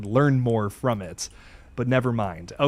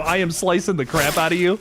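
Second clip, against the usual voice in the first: ordinary delivery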